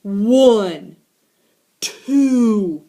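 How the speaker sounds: slow count building to loud; building stern count. Acted. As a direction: count it out like a parent losing patience. Counting to punish.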